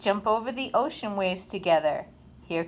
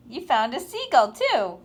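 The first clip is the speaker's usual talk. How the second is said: usual speaking voice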